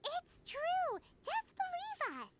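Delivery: high and pleading, eager to convince; eager insistence. Acted. Companion urging a doubtful friend to believe, eager and high. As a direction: insist brightly so she believes you.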